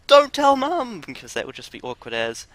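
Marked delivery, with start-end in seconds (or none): 0.1-1.0 high, loud pleading cry. Retold or imitated. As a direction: beg like a panicked kid. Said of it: "wanna go in and be like".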